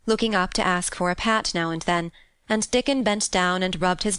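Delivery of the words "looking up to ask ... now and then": plain reading voice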